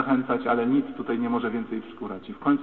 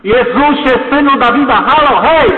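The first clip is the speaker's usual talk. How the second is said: loud, high, desperate call for attention; full-voiced shout; 12 semitones higher, 15 dB louder than usual. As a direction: yell at the top of your lungs to be heard. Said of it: "że się niekulturalnie zachowuję". (krzyk na całe gardło)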